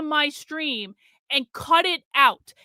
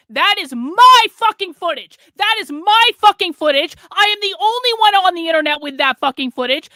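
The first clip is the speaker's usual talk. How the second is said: loud, higher enraged yelling; furious shout; 4 semitones higher, 7 dB louder than usual. Real, not acted. Streamer yelling at his audience that the footage is his, furious shout. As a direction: yell it, seething with real fury. Ranting about stolen footage.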